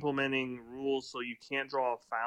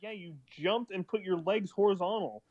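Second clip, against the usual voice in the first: ordinary talk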